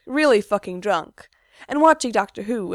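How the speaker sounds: ordinary delivery, nothing marked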